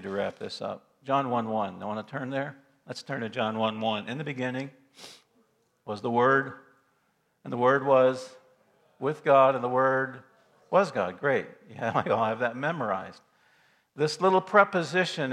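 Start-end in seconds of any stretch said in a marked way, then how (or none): 4.1-11.2 each phrase set apart by long pauses, building louder; solemn scripture recital. Acted. Preacher to congregation, solemn recital of the verse. Reciting John one one slowly.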